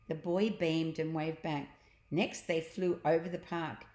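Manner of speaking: plain reading voice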